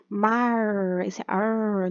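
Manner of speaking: "mar" and "ar" with a held final R; syllable-final R. Shown as a demonstration. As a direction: stretch the R at the end of "mar" and "ar". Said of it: "o som de R aí no final da sílaba". ("mar" e "ar" com o R final prolongado)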